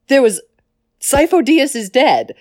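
normal talking voice

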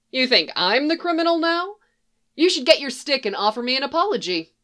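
half-amused scolding; woman pretending to be offended; amused mock outrage. A narrator's reading. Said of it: "her voice could be amused instead of outraged".

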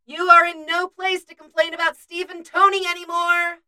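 high, sustained call from another room; calling through. A narrator's reading. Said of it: "a voice calls through from the rec area".

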